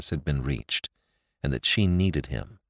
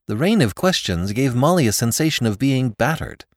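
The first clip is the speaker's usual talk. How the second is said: plain reading voice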